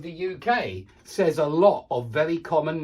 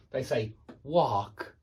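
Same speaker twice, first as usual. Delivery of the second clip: 1 American wok; 3 semitones lower, slower than usual. Shown as a demonstration. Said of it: "I'm not very good at doing accents".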